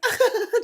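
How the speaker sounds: high-pitched burst of giggles; squealing reenacted laugh; imitated helpless laughter. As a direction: act out a helpless laughing fit. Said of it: "just laughing".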